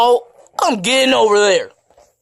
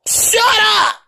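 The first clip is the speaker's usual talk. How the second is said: angry shout; 13 semitones higher, 3 dB louder than usual. Acted. Toy characters quarrel.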